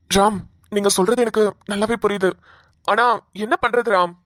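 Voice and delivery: ordinary talk, nothing marked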